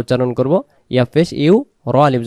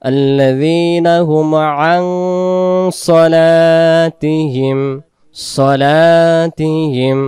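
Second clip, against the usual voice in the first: stretched melodic tajweed; slow Quran chant; slow recitation; 4 semitones higher, 5 dB louder, much slower than usual. Acted. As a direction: draw each syllable out.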